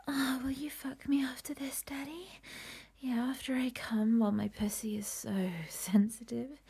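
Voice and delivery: breathy, unvoiced sultry whisper throughout; intimate whisper. Whispering desires into her partner's ear. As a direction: whisper close to the mic, pleading and teasing.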